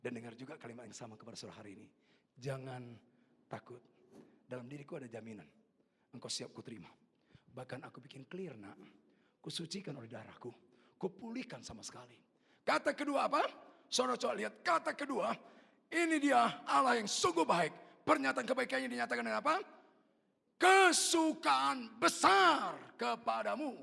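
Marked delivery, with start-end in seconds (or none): 2.3-12.2 low, measured, fatherly reassurance; calm assurance. Retold or imitated. God answering the ashamed sinner, calm and low. 12.7-23.8 loud, high, drawn-out proclamation; full-force preaching. Real, not acted. Preacher proclaiming great joy to the congregation, full force.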